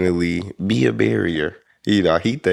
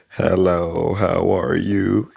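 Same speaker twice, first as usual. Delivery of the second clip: very slow, drawn-out hello; much slower than usual. Retold or imitated. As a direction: stretch every word out. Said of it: "he said he slowed it down".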